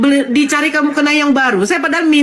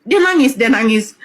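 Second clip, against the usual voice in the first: ordinary delivery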